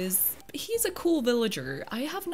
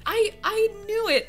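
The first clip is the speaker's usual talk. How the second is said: exasperated outburst; 8 semitones higher, 6 dB louder than usual. Real, not acted. Sure plot was sold.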